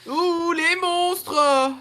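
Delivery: long, very high holler; loud yell. Real, not acted. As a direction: call out the monsters at full volume. Yells on seeing monsters.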